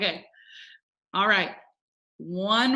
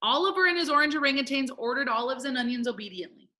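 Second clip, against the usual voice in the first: quick, unbroken tongue twister; really fast; 3 semitones higher than usual. Shown as a demonstration. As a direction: rattle off the whole twister. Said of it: "say it together really fast".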